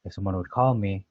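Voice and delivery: ordinary delivery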